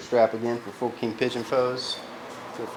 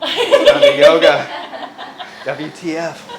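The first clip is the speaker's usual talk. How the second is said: laughing; 6 semitones higher, 9 dB louder, slower than usual. Real, not acted. Joking slogan, laughing.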